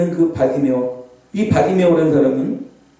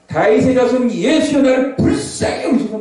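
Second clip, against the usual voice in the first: restrained cry for mercy, raised pitch; сдержанный крик; 6 semitones higher than usual. Acted. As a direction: call out slowly, holding the volume back. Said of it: "제가 소리 좀 낮췄어요".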